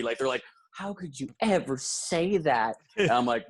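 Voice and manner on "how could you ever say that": slow, lightly acted storybook reproach; Disney movie reproach. The couple staring at him after the threat, slow, hammed-up reproach. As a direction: ask it like a wounded cartoon character. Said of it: "It's like some Disney movie".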